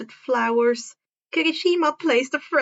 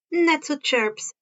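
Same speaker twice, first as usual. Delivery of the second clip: usual speaking voice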